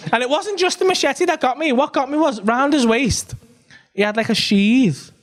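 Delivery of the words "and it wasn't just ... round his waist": usual speaking voice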